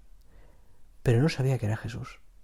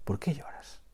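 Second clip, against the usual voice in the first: a tender, breathless "¿Por qué lloras?" with the rise on "qué"; consoling whisper; 4 semitones higher, 6 dB quieter than usual. Shown as a demonstration. The angels gently asking weeping Mary, a consoling whisper. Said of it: "esta frase como a, a consuelo".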